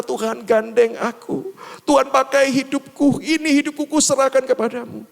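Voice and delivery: high, earnest plea of surrender; prayerful plea. Acted. Believer asking God to hold their hand, high prayerful plea.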